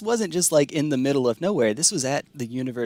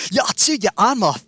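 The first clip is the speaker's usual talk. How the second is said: higher, louder imitation of a Scottish girl accent; playful Scottish girl threat, pitched up and loud; 8 semitones higher, 8 dB louder than usual. Streamer imitating his ex's Scottish girl accent, higher and louder. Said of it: "she would actually do a Scottish accent".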